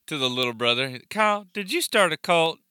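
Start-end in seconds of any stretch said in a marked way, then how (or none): 1.1-2.6 mom voice. Retold or imitated. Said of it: "the mom when he's like".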